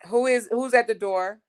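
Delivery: loud high call, rising at the end. Real, not acted. Asking her household who is ringing the bell, loud rising call. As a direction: shout the question toward the front of the house. Calling to person at door.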